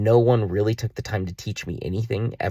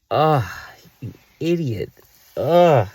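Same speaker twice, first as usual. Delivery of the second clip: loud frustrated ugh at himself; disgusted groan; 4 semitones higher, 3 dB louder, slower than usual. Real, not acted. Man kicking himself over lost chances, groaning loudly. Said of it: "self-disgust groan".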